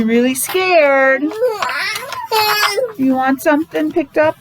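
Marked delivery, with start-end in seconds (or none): none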